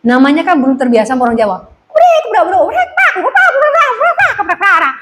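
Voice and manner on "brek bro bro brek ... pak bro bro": high-pitched gibberish imitation of Batak talk; comic Batak babble; mock Batak speech. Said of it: "Kan ngomong bahasa Batak".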